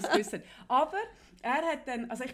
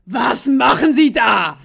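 a loud, slow mock yell; imitated furious shout; 16 dB louder, slower than usual. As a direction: yell it slowly like an outraged clerk. Wütender Zollbeamter, nachgespielt. (laut und langsam nachgespielter Schrei)

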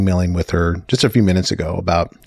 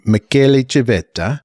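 put-on Michele Civetta with an Italian accent, rolling and rising; 6 semitones higher, 3 dB louder than usual. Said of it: "I cannot help but speak in an Italian accent".

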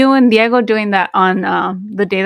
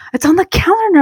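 exasperated retort; 10 semitones higher than usual. Retold or imitated. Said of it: "I was like".

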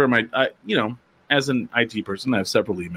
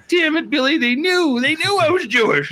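much higher, hammed-up frustrated complaint; mock exasperation; 3 dB louder than usual. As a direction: whine it at your friend like a wronged man. Retold outburst to Billy.

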